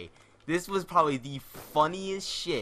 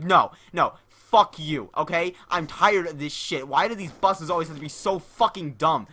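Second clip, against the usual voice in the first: loud mock rant, voice pushed up; imitated furious rant; 3 semitones higher, 5 dB louder than usual. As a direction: blow up at the guy like you've had enough. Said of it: "He's like".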